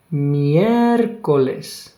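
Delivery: Miércoles slow with the stress held hard on the mié; exaggerated stress. Shown as a demonstration. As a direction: lean heavily on the mié of Miércoles, slowly. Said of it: "I'm exaggerating for you to".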